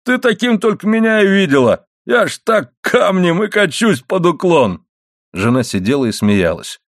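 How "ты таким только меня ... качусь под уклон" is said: high-pitched, put-on husband's complaint; protesting husband. A narrator's reading. Husband insists to wife he is going downhill, protesting. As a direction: voice an indignant husband, slowing toward the end.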